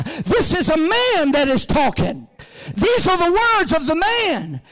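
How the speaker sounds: high-pitched pulpit proclamation; forceful preaching. Real, not acted. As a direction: preach it hard, driving to a peak.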